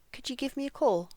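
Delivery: "Could you" run into "couldja"; reduced linked form. Shown as a demonstration. Teacher puts it in the phrase, "Could you" as "couldja".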